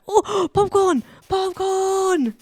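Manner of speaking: high, loud squeal of discovery; excited delight. Real, not acted. Streamer finds popcorn in the game, excited delight. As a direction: gasp and cry out, stretching the last word.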